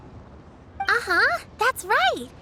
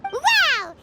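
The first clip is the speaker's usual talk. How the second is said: excited wow; 5 semitones higher, 3 dB louder than usual. Real, not acted. Paimon impressed by rock spirit.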